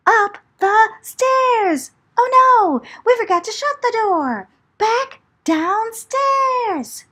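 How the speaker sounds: high, loud, frantic cries; panicked storyteller climax; panicked escape. A narrator's reading. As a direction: scramble home in terror.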